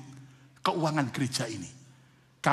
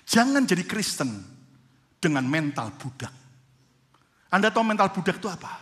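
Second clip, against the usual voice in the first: ordinary delivery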